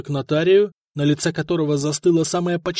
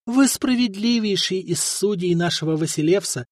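ordinary delivery

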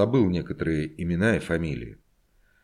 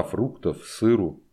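ordinary delivery

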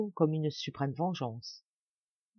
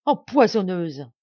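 loud, slow cry of accusation; shouted accusation; 10 dB louder, slower than usual. A narrator's reading. Dying Marianne crying out at her poisoner, loud and slow. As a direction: hurl the word at her. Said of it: "elle s'écria".

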